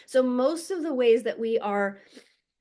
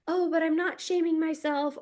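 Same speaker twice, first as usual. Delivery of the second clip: higher put-on voice, breezily denying; mock denial; 6 semitones higher than usual. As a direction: play someone pretending they're fine. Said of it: "and then you'll be like".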